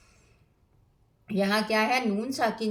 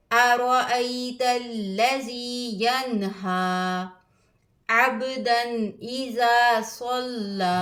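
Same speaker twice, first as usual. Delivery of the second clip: slow, drawn-out melodic recitation; Quran recitation; much slower than usual. Acted. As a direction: chant each verse with tajweed. (धीमी क़ुरआन तिलावत)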